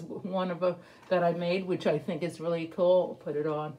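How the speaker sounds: muffled by cloth mask; speaking while removing mask; through a face mask. Real, not acted. Seen 0: Cloth mask covers mouth at start, pulled off by 0.8s.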